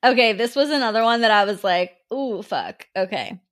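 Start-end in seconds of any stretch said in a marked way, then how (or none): none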